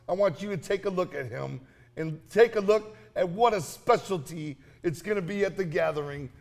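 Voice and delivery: normal talking voice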